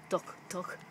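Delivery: breathy whisper for the farmer's reply; whispered story line; whispered aside. Pretend. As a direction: drop to a secret whisper. Reading the book aloud.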